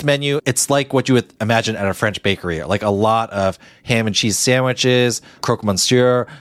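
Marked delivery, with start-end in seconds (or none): none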